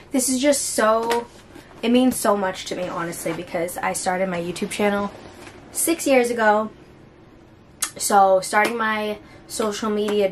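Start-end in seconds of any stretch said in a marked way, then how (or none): none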